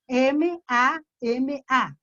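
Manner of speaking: slow, each letter of "M A M A" spoken apart. Shown as a demonstration. Teacher sounds out mamá letter by letter for parents. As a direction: sound out "M A M A" one letter at a time, slowly. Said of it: "Por ejemplo, mamá".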